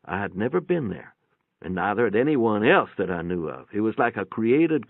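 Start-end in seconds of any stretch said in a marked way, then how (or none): none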